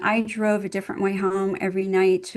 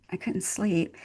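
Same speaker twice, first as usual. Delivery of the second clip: ordinary talk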